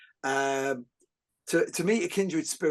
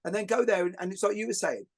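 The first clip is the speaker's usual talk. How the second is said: ordinary talk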